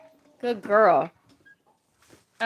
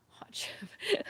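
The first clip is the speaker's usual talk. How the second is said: breathy whisper of the words, much higher; whispered hot chip; 14 semitones higher, 9 dB quieter than usual. Real, not acted. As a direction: whisper it to the camera like a secret. Whispering the snack's name.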